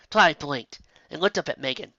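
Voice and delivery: plain reading voice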